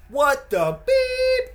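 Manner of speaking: put-on high, loud, drawn-out kid voice with a bleep on beep; son's self-bleep. Imitating his son censoring himself with a beep sound. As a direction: squeal out a slow, loud bleep in place of the swear. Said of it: "literally makes the beep sound himself".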